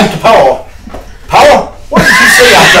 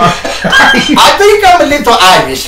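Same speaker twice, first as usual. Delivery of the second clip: Irish pirate; 5 semitones higher, slower than usual. Acted. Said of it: "Are you Irish or a pirate?".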